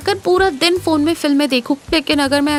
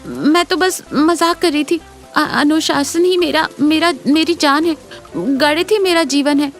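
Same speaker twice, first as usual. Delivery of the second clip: higher, stammering embarrassed denial; nervous, pitched-up young teacher; flustered backtracking; 5 semitones higher than usual. Acted. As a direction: stumble over words while covering up. Flustered teacher hastily taking back confession.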